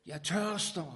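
loud, high cry of thirst from the cross. Acted. Jesus on the cross crying out his thirst, loud. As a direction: call it out like a dying man's cry. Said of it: "råbte han".

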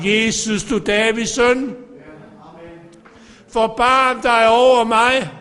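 crying out in prayer. Acted. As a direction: call out to Jesus for mercy. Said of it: "råbe til ham". (langsomt, højt bønfaldende råb)

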